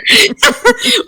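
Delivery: high burst of real laughter; bright giggle. Real, not acted. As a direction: crack up at the slip. Laughs at own misreading.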